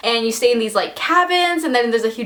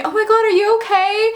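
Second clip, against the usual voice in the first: much higher-pitched worried question; imitated concerned friend; 9 semitones higher than usual. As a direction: act out a friend checking on you. Voices concerned friend after fall.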